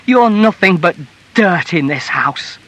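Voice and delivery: loud, high burst of loathing; wife's furious outcry; crying out. A narrator's reading. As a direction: hurl the insult at him. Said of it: "she cried".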